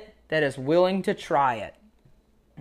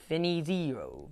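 a soft, hesitant try at Finizio in an Italian accent; 8 dB quieter, slower than usual. Shown as a demonstration. Host trying the coach's name in an Italian accent, softly. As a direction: roll out Finizio in a half-hearted Italian accent, quietly. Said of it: "I had to say it like an Italian as best I could".

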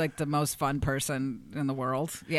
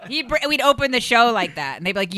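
ordinary talk

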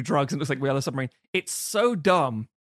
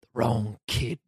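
mock grim father; 4 semitones lower, slower than usual. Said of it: "Robert Patrick might as well say".

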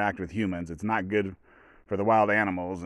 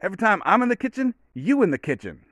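high-pitched, quick movie-dad voice, dropping at the end; Friday dad impression; 10 semitones higher, 3 dB louder than usual. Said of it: "his dad comes in".

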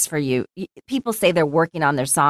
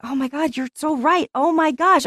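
mock amazement; 9 semitones higher than usual. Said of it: "And I was like".

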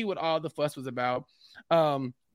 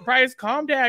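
higher, louder mock plea to settle down; calming a friend; 6 semitones higher, 5 dB louder, slower than usual. Telling an excited friend on the phone to calm down. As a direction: talk a hyped friend down. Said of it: "I'm like".

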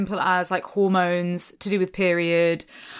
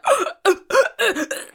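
gasp; 13 semitones higher, 4 dB louder than usual. Retold or imitated. Retelling TV proposal reaction.

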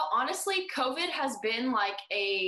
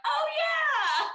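high, loud squeal of sudden recall; delighted realization; 5 dB louder than usual. Retold or imitated. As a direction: act out it suddenly dawning on you. Retold sudden realization on hearing accent.

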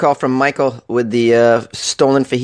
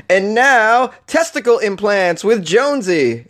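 high, slow, grandly hammed-up show intro; hammed-up broadcast handoff; mock segment announcer; 8 semitones higher, slower than usual. Mock segment intro between grim news.

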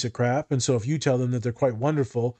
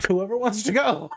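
sheepish handover; 7 semitones higher than usual. Real, not acted. Hands over after embarrassing joke.

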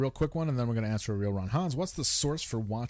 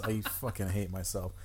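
normal talking voice

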